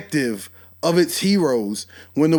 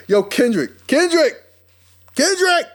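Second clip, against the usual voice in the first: calling out; 8 semitones higher than usual. Real, not acted. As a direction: shout his name up the scale, half laughing. Calling out to Kendrick.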